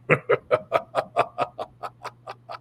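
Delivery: slow run of breathy, voiceless chuckles; whispered "heh-heh-heh", drawn out; imitated eerie laugh. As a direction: imitate a ghostly recorded laugh. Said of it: "you just hear this thing start laughing".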